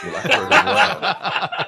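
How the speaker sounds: blurted through laughter, high and loud; laughing exclamation. Real, not acted. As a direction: laugh it out, stretched slow. Laughing outburst.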